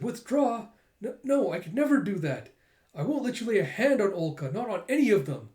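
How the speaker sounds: high, emphatic heroine voice; indignant refusal. Acted. Yor defends Olka from the assassins, indignant and high. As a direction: refuse with rising urgency.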